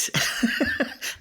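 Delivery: soft, quiet burst of laughter; a light amused laugh. Real, not acted. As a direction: laugh at your own son's craze. Host amused at son story.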